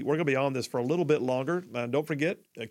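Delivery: ordinary delivery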